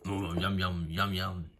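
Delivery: low, lightly voiced monster yum-yums; munch it like a hungry monster. Cookie Monster eating again.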